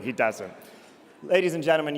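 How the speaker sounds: normal talking voice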